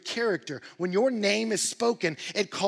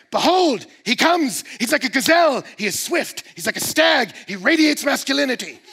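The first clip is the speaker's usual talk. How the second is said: louder and higher, bursting with joy; excited bride; 7 semitones higher, 12 dB louder than usual. Retold or imitated. Preacher voicing the bride's thrill at her beloved, excited. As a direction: gush like she sees him coming. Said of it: "let you know she's excited".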